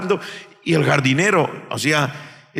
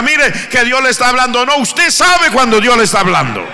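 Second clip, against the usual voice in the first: loud, high, driving preacher's cry; fiery preaching; 6 semitones higher, 5 dB louder than usual. Real, not acted. Preacher insists the congregation knows God's voice, shouting. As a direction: preach it out to the whole church.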